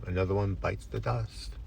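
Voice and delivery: usual speaking voice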